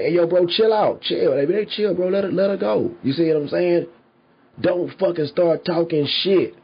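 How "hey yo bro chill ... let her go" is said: calming a fight. Retold or imitated. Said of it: "they gonna be like".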